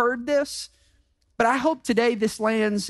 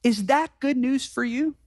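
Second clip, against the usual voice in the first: ordinary talk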